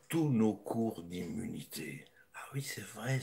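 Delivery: slow sarcastic whisper. Real, not acted. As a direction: whisper it like a secret. Mocking doctor, sarcastic aside.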